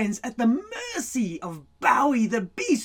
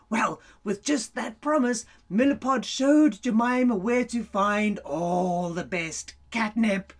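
plain reading voice

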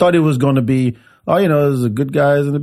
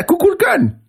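high mocking name. Real, not acted. Mocking how they call Namor, high. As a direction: say it in a high silly voice.

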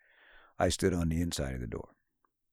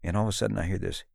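ordinary talk, nothing marked

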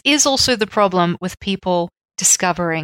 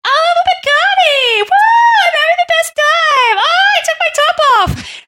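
put-on very high, loud party-girl squeal; woo girl; 5 dB louder than usual. Woo girl drinking Bacardi, mock ecstatic shrieking. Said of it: "it's so much the woo girl drink".

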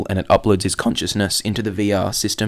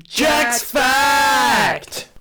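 slow drawn-out announcer shout; 10 semitones higher, much slower than usual. Pretend. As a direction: boom it out like a show jingle. Jingle-style segment sign-off.

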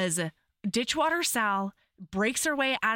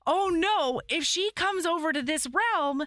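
higher-pitched, playful worry; mock alarm; 6 semitones higher than usual. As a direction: act out a hammed-up "oh no". Voices hero's alarm in retelling.

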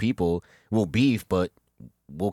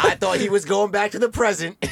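laughing; 11 semitones higher than usual. Real, not acted. Laughing while speaking.

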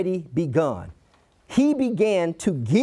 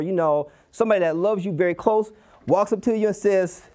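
ordinary delivery

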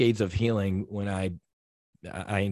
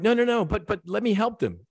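high, pleading protest; mock-urgent plea, much higher; 11 semitones higher than usual. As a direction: beg to stay and help, voice shooting up. Retold pleading with God.

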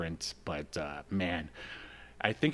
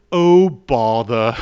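a hint of Winnie the Pooh, loud and slow; 13 dB louder, much slower than usual. Tired comic cop voiced as Pooh, loud and slow. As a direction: drawl it out like a slow Pooh bear. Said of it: "a la Winnie the Pooh".

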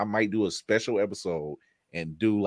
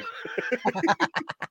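high villain cackle; evil laugh. Real, not acted. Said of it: "There it is".

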